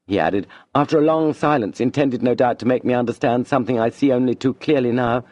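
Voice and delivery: ordinary delivery, nothing marked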